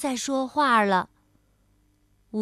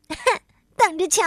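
huffy, higher, louder threat; angry; 6 semitones higher, 5 dB louder, much faster than usual. A narrator's reading. Tiaotiao threatens after being shoved, angry. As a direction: snap it out in a huff. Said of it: "生气地说".

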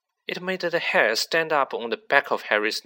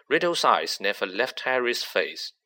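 ordinary delivery

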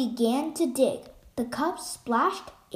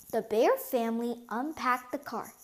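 plain reading voice

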